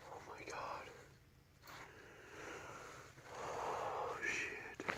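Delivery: breathy whisper, no voice. Real, not acted. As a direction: whisper so it won't fly off. Creeping near the bird.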